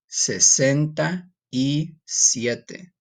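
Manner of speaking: Sesenta y siete at a slow, deliberate pace; slow Spanish. Shown as a demonstration. Teacher slowly modelling the number for students. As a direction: drag each word out for learners.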